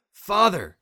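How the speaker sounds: put-on boy screaming for his father as the music hits; tried to scream. A narrator's reading. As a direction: cry out for his father in panic. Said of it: "he tried to scream".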